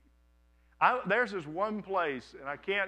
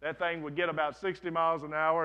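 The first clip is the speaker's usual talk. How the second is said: ordinary delivery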